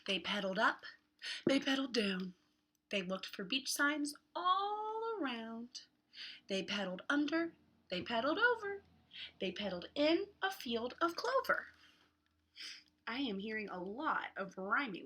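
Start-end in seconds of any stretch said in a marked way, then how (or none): 0.1-11.7 lively picture-book read with voiced characters; reading to children. Teacher reading a beach book aloud to kids, lively. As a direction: read it like story time for little ones.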